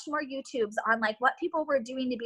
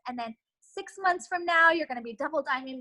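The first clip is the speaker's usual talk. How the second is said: normal talking voice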